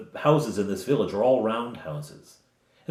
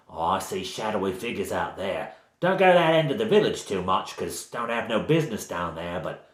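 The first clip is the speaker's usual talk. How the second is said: bad Cockney accent. Village woman warning about shadowy figures, in bad Cockney. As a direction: confide it in rough mock Cockney. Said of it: "she has a sort of bad Cockney accent".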